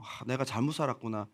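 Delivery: low, soft acted remorse; burdened realization. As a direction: murmur it to yourself with a heavy heart. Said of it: "마음속에 부담이 되고". (부담을 느끼며 낮고 작게 뉘우치듯)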